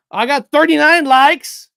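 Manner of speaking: loud, high and thrilled; excited announcement. Real, not acted. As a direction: shout out the new number with delight. Excited count of likes on stream.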